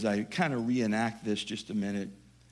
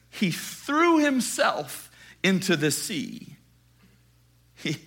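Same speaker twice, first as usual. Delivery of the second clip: ordinary talk, nothing marked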